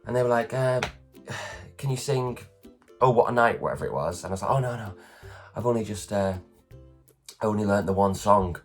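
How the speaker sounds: ordinary delivery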